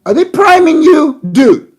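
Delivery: high, loud mock-incredulous demand; incredulous outburst. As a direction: badger him loudly with the question. Mock questioning of Abadi escalates.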